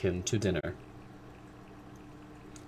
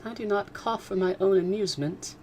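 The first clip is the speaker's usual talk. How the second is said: put-on high, fretful young girl; Kitty answering her father fretfully; 11 semitones higher than usual. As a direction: whine it as a hurt teenager. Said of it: "replied Kitty fretfully".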